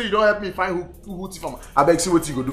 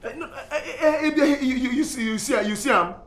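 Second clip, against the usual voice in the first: high-pitched, stumbling protest; flustered stammer; 9 semitones higher than usual. Acted. Accused man flustered, stammers his answer.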